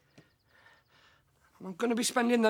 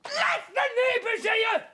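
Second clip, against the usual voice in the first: defiant shout; 10 semitones higher than usual. Acted. Man defying his friend about the neighbours, shouting.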